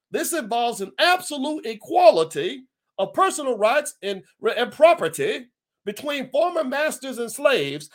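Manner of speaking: high-pitched put-on Southern accent. Host reads General Gordon's proclamation aloud, Southern accent. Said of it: "I'm gonna use my Southern accent".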